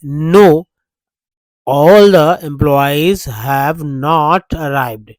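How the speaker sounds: loud "No", then slow, spaced "all the employees have not arrived"; slow negative model. Shown as a demonstration. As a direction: spell out the negative answer word by word. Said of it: "बोलेंगे ऐसे". (धीमा नकारात्मक आदर्श उत्तर)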